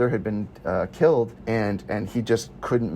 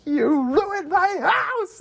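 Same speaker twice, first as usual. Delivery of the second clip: slow, drawn-out high screech; terrifying scream; much slower than usual. Retold or imitated. Attacker screaming at the hero over her house, high drawn-out screech. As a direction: imitate a horror-movie shriek, stretching the words. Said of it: "like a terrifying last-minute, uh, horror movie moment".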